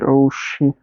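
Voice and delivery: mock alarm. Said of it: "She's like".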